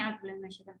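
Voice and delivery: quick soft mumble, words swallowed; wrong, hushed way. Shown as a demonstration. Teacher showing students how not to begin, hushed mumble. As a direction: murmur it under the breath as if skipping past. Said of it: "اس طرح سے نہیں".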